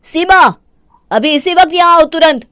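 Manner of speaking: loud, high angry call; shouting for wife. Acted. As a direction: bellow her name across the house. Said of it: "क्यों चिल्ला रहे हो". (पत्नी को गुस्से में ज़ोर से पुकारना)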